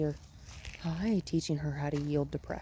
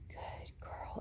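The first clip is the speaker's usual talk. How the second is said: whispered praise; 15 dB quieter than usual. Real, not acted. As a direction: breathe the praise to calm the dog. Soothing the dog in whispers.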